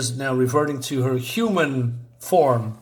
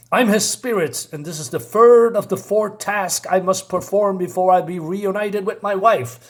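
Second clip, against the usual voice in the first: lightly acted spirit, pitched up; solemn declaration; 6 semitones higher, 4 dB louder than usual. Spirit telling Adam of his task, mildly declamatory. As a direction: declare your task with raised, sustained voice.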